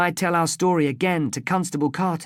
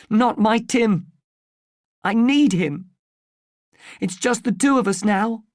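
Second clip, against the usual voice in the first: distraught mother; 6 semitones higher, 4 dB louder than usual. Acted. Distraught mother refuses, clinging to son.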